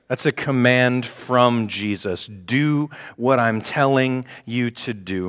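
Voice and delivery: "command" spelled out slowly; slow emphatic. Shown as a demonstration. Preacher presses the point on his congregation, slow and firm. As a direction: lay each word down slowly and firmly.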